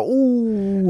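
high, loud, startled "ooh"; shocked ooh. Retold or imitated. Hearing the two-hundred-dollar fee, a high shocked ooh. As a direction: sound caught off guard.

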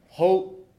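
Hope lifted high and loud, rising; emphatic. Acted. Preacher to congregation, punching the word Hope. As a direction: hit Hope hard and bright.

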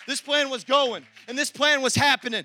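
building preacher's cadence, loud; preaching at full force over music. Acted.

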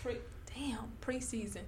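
awed whisper. Real, not acted. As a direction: breathe it in disbelief. Amazed at more stages.

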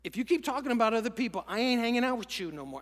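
loud, fast, blunt New York ultimatum; New York approach. Shown as a demonstration. Preacher shows the blunt New York way to friend. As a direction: snap it like a fed-up New Yorker. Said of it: "That's the New York approach".

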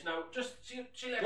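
high, hurried goodbye while escaping; flustered exit. Acted. Fleeing his colleague George, high and flustered. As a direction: squeak it out as he bolts.

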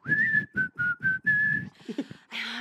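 high whistled tune; tuneful, high whistling. Shown as a demonstration. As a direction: whistle a cheery little melody. Whistles the tune after singing.

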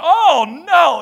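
loud, high "Oh, no"; flat refusal, voice thrown up high; indignant refusal. Retold or imitated. Mom refusing to wait in the waiting room, loud. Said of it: "She was like".

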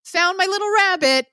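put-on a kid who found her toy, sing-song and high; little girl voice. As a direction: pipe it up like a careless little kid. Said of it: "and Agnes is like".

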